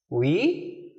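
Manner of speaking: ordinary talk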